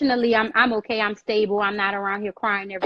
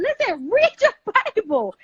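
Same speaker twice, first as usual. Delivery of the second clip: 1 emphatic urging; 10 semitones higher than usual. Real, not acted. Preaching about reading the Bible.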